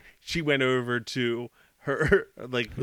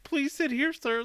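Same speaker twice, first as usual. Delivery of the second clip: higher-pitched mimicked waitress; imitated waitress; 10 semitones higher, 3 dB quieter than usual. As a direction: lightly imitate an unbothered waitress. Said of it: "then she's just like".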